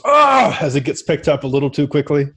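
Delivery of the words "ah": high, loud startled cry; startled yelp. Acted. Orc yanked up too quickly, high startled yelp. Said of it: "And he goes".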